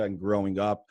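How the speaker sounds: usual speaking voice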